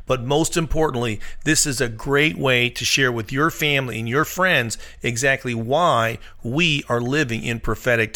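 loud, pumped channel promo; promo read. Acted. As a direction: sell it with loud energy.

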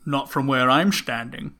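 slow spy-movie comeback; slurred, unhurried spy retort; James Bond. Acted. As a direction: draw it out as a suave secret agent. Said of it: "Mister Bond".

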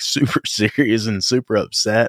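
ordinary talk

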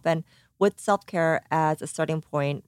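normal talking voice

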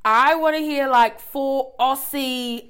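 loud, drawn-out mock Aussie accent; bad Australian accent. Shown as a demonstration. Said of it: "I have a bad Australian accent".

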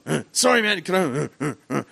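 nervous stammer. Retold or imitated. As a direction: fumble for words, starstruck. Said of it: "and I'm like".

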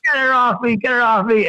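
high, urgent plea; begging the charmer to remove the snake, scared; pleading. Retold or imitated. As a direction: beg him to get it off. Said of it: "And I'm like".

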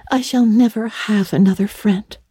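put-on high, doleful girl's voice; forlorn young Anne. A narrator's reading. Anne informs Marilla of the parting, forlorn.

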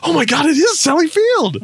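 high, bright shock at the discovery; amazed realization. Retold or imitated. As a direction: gasp it out like you just recognized her. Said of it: "look it up and go".

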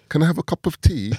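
high, hammed-up posh English accent; mock English accent. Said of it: "That you always sound like".

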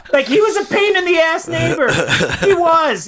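words breaking into laughter; laughing through it, can't hold it in. Real, not acted. Laughing while telling friends about the neighbor.

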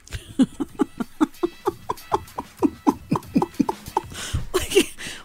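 long high wheezing laugh; helpless laughing. Real, not acted. Radio host ending a silly joke to a co-host, helpless laughing. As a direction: crack up at your own punchline. Teller cracks up at own joke.

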